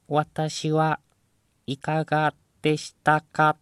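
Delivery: high, loud machine voice; robot voice. Retold or imitated. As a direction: announce it like a talking machine. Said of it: "ロボットの声みたいなの出てきて".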